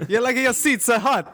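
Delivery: mock Italian accent, laughing. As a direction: put on a jokey Italian voice. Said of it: "your Italian accent's almost Indian".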